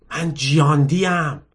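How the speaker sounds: usual speaking voice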